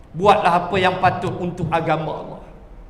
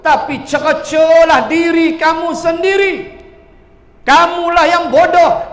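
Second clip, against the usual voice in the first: higher and louder taunting devil; Satan mocking loudly; 7 semitones higher, 5 dB louder than usual. Satan in hell scorning his followers, loud and jeering. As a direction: jeer at them as Satan blaming fools. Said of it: "Setan kata".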